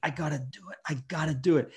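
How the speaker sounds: whispering I gotta do it through pain; whispered self-urging. Retold or imitated. Whispering to himself while forcing the injured arm. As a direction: urge yourself on under your breath.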